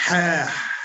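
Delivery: "Ah" as a breathy throat exhale; like out of breath. Shown as a demonstration. Teacher coaches a student's letter, out-of-breath Ah. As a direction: exhale "Ah" as if winded. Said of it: "Like you're out of breath".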